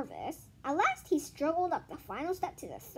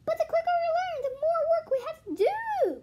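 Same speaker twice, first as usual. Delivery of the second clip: put-on much higher kid whine; complaining; 7 dB louder than usual. Todd to the teacher, high whine. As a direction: whine about the extra work. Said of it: "complained Todd".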